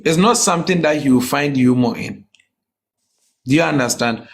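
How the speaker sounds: ordinary talk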